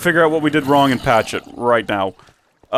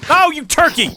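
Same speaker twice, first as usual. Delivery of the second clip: exasperated outcry; 3 dB louder than usual. Real, not acted. Mobs kill him, outcry.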